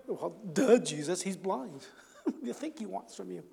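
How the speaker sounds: sarcastic, quiet and laughing; mocking duh. Bystanders mocking Jesus' question, sarcastic laughing. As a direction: roll your eyes at the question. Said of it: "Everyone's standing around going".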